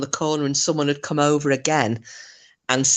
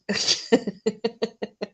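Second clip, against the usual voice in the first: laughing; 7 semitones higher, 5 dB quieter than usual. Real, not acted. Laughs at own joke.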